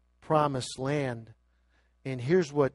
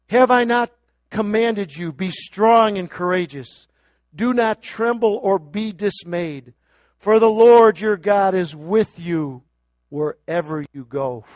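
loud, higher scripture proclamation; preaching; 5 semitones higher, 10 dB louder than usual. Real, not acted. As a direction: thunder the command. Scripture cried out.

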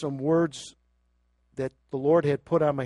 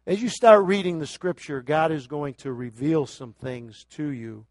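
ordinary talk

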